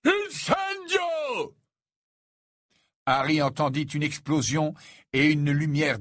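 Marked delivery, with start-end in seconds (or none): 0.0-1.5 very high, drawn-out shouted incantation; howled spell. A narrator's reading. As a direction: yell the spell, stretching it out. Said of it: "hurlait derrière lui".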